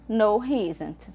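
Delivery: slow, clear short form; slow model answer. Shown as a demonstration. Teacher modelling the short negative form, slow and clear. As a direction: read the contracted answer slowly for the class. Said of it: "forma longa como a forma curta".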